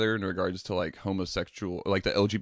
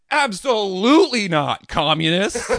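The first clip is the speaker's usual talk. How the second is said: much higher, louder, slow indignant bellow; mock establishment outrage; 11 semitones higher, 8 dB louder, slower than usual. Establishment to protesters, a hammed-up outraged refusal. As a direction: play a pompous official scandalized by the idea. Establishment voiced mocking rights demands.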